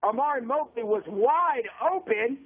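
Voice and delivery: radio football call, pitched above casual chat; live play-by-play. Real, not acted. Announcer calls a defensive play and punt to listeners, live play-by-play. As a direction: call the game live, stretching the key words.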